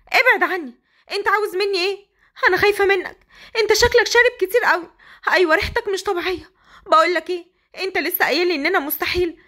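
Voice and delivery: put-on frightened young bride. Hasnaa pushing her drunk husband away, high and frightened. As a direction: push him off, scared and protesting.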